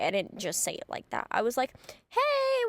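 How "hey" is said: high, chirpy "Hey"; peppy fake-friendly hey. Texting the ex-friend, a sugary high "Hey". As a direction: sing out "Hey" sweet and bright, passive-aggressive. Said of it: "with, like, exclamation point".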